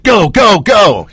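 high, loud eager yell; excited shout. Retold or imitated. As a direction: shout it like a green light. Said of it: "And I was like".

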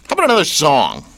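normal talking voice

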